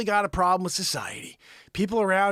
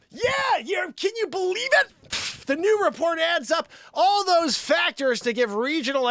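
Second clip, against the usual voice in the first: high, incredulous yelling; screaming at her; 7 semitones higher than usual. Acted. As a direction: shout the news at her. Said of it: "unless you scream at her".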